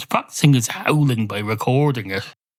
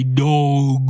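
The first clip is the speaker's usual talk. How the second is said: dog saying dog. Retold or imitated. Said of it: "Why would a dog come on and say".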